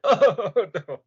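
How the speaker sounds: loud, high mock-horrified groan; mock dismay. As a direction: recoil in exaggerated dismay at an awful voice. Acted horror at hearing her accent.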